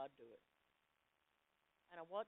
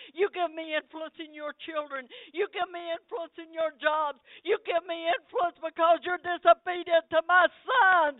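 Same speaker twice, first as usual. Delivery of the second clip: loud, high hammering sermon; preaching at full force; 11 semitones higher, 23 dB louder than usual. Acted. Preacher exhorting the congregation, loud and fervent. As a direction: drive each line home.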